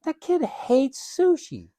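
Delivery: put-on child's protest. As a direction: play the sulky kid who hates sushi. Parent voicing child's silent protest.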